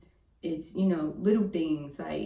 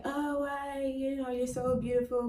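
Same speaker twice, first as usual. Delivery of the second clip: imitating a flatterer; 8 semitones higher than usual. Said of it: "you're probably gonna be like".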